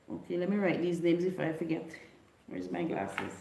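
usual speaking voice

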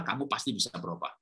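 low, soft encouragement; gentle assurance. Shown as a demonstration. Parent assuring a child change is possible, gentle assurance. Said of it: "kalimatnya adalah".

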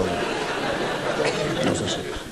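hushed, drawn-out whisper of the phrase; slow confiding murmur to the crowd; whispered aside. Real, not acted. Comic repeats the point to the audience in a slow whisper. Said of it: "She's got no sense of humour".

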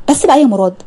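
flustered bas ba'a; embarrassed protest. Acted. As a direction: push him off with mock outrage. Fariha embarrassed by kiss request.

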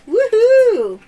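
high, loud cheer; host cheering the t-shirt win; excited whoop. Real, not acted. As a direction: whoop in delight. Celebrating prize.

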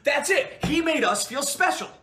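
high, loud proclamation; acted outburst. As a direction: burst out and proclaim it. Repeated take of acted outburst.

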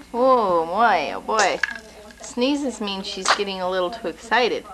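normal talking voice

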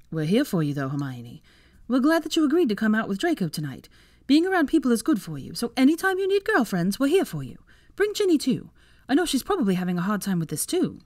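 high, emphatic voice of a caring friend; supportive girlfriend. Acted. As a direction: rally round her, pitch climbing high. Voices Tracy offering support.

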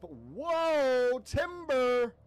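high, loud drawn-out holler; playful lumberjack shout; calling timber. Pretend. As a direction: holler it like a falling-tree warning. Mock lumberjack warning as thing falls.

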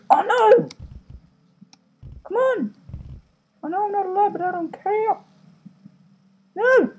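very high excited voice; high-pitched squeal. Real, not acted. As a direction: squeak it out, giddy. Squealing during gameplay.

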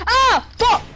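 loud high yell then laugh; loud outburst. Real, not acted. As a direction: yell in frustration. Game death outburst.

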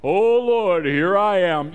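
loud, showy, theatrical prayer voice; mocking a hypocrite's grandiose prayer; pompous mock prayer. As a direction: put on a grand churchy tone to mock a fake. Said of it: "with the attitude of".

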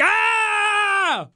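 high, screamed mock panic; horrified audience. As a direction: shriek like an appalled crowd seeing a monster. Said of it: "the audience's reaction when they saw Sonic".

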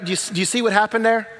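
ordinary talk

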